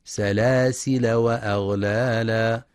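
سلاسل with open lam and no tanween, slow; Hafs reading. Shown as a demonstration. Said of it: "بفتح اللام من غير تنوين".

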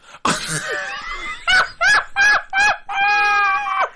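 long, loud, squealing laughter; high laughing fit. Real, not acted. Co-host asked if guest is having a stroke, helpless high laughter.

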